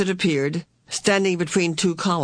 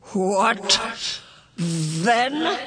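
echoing, drawn-out higher voice; otherworldly Mrs Which; 6 semitones higher than usual. A narrator's reading. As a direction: intone it slowly, like a reverberating apparition. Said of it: "Missus Witch's surprising voice".

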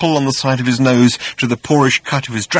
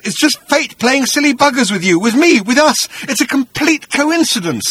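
higher, anxious reasoning voice; pleading Arthur; 5 dB louder than usual. Acted. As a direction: try to placate the monster.

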